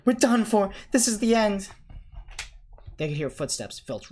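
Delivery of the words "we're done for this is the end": loud, high despairing wail; trapped boy wailing at a locked door; panicked cry. A narrator's reading.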